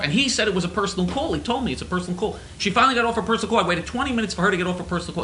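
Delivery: usual speaking voice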